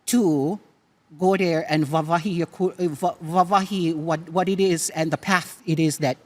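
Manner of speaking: usual speaking voice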